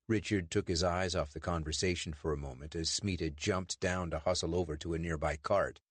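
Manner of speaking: ordinary delivery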